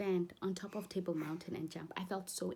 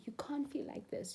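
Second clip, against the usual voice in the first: dismissive quote; 6 semitones higher, 4 dB quieter than usual. Retold or imitated. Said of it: "someone who's gonna say".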